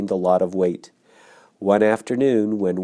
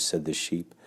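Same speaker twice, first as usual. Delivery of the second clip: ordinary delivery, nothing marked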